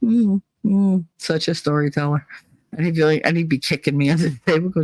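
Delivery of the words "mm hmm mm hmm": sarcastic mm-hmm. Retold or imitated. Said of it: "I just usually go".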